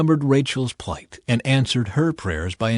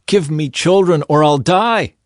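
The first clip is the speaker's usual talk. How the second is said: a touch of desperate pleading, a bit louder; 5 semitones higher, 6 dB louder than usual. A narrator's reading. Rachel begging Jacob for children, desperate plea. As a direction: beg for children as if life depends on it. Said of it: "She pleaded with Jacob".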